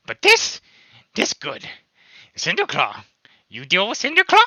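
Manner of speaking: high, loud goblin voice; eager goblin pressing the question. Acted. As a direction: goblin grinning, pushing for a deal. Goblin Helba asks eagerly.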